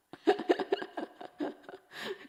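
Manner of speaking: high, airy trailing laughter; helpless laugh. Real, not acted. Laughing on after her own anecdote, helpless laugh. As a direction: keep laughing until the breath runs out.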